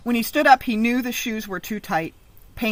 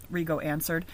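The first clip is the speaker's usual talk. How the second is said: plain reading voice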